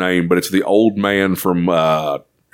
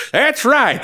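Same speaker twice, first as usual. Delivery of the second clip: high puppet-like voice; bystander character. Acted. Character backs up the chef's challenge, high put-on voice. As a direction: confirm it in a pitched-up character voice. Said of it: "and the guy goes".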